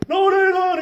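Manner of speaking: high, loud shouted denial; arguing back. Retold or imitated. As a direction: shout him down, insisting you are right. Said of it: "he's arguing with me".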